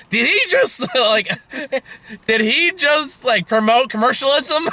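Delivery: high, incredulous squeak; mimicking partner's outrage. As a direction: squeal it in shocked disbelief. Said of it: "And you said".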